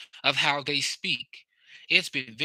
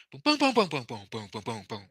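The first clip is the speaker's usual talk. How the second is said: talking drum imitation; 3 semitones lower, 3 dB quieter, faster than usual. Shown as a demonstration. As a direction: mimic a pitched drum, fading as it drops. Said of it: "That's what it sounds like".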